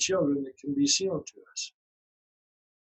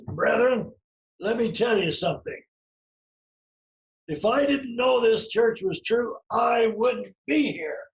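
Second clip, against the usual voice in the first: dad's preaching voice; 8 semitones higher than usual. Retold or imitated. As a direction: preach it to the brethren, drawing out the last words. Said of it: "this is the way he talked".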